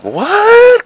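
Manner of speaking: very high, loud stretched what; baffled what. Retold or imitated. Gullible boy hearing of a fart rock, shrill disbelief. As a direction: squeal it in disbelief. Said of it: "I'm like".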